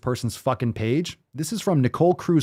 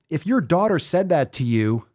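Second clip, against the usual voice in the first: ordinary delivery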